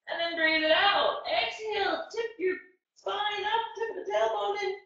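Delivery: soft, calm yoga guidance; guided yoga. Acted. Teacher guides kids through calm yoga breathing.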